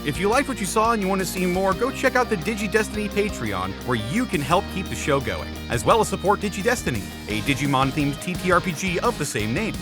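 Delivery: ordinary talk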